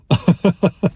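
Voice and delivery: short high chuckle; brief burst of high chuckling; laugh. Real, not acted. Speaker amused at retelling his own apology, short laugh.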